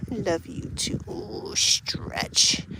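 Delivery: soft unvoiced whisper while petting; whisper to dog. Real, not acted. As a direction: hush your voice to a whisper for the dog.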